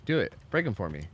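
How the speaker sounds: ordinary talk, nothing marked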